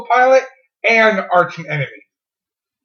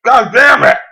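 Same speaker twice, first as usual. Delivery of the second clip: annoyed shout; 3 semitones higher, much slower than usual. Real, not acted. Wrong answer, takes shot.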